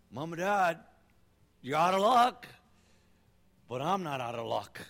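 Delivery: loud smug taunt to the parents; gloating shout. Acted. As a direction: crow over them loudly. Voicing selfish son, gloating.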